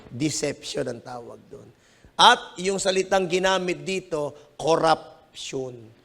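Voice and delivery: normal talking voice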